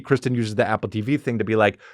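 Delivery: ordinary talk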